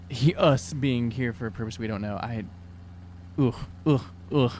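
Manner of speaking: normal talking voice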